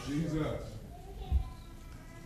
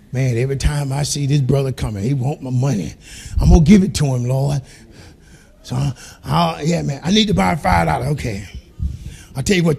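much lower, quiet grumbling aside; muttered aside; 10 semitones lower, 7 dB quieter than usual. Acted. Pastor grumbling about a borrowing brother, low and quiet. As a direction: grumble it under your breath.

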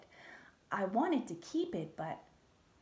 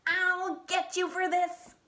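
high, loud, slow threat; angry shout; 9 semitones higher, 6 dB louder than usual. Acted. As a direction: yell the threat after her.

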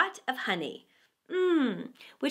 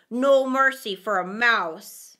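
stern weasel; 7 dB louder than usual. A narrator's reading. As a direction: flatly deny the bat. Second weasel voiced.

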